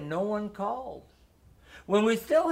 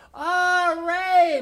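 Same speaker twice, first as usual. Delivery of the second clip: cheering; 11 semitones higher, 7 dB louder than usual. A narrator's reading. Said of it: "my brother cheered".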